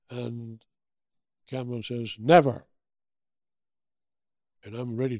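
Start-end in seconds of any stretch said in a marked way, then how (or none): 2.2-2.7 loud declaration. Retold or imitated. Cameron's line after the take.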